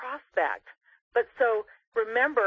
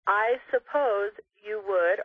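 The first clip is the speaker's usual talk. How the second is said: slow demo line; much slower than usual. Shown as a demonstration. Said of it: "So what we wanna say is".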